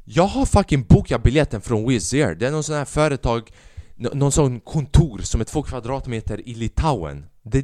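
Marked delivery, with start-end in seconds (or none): none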